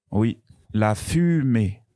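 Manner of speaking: usual speaking voice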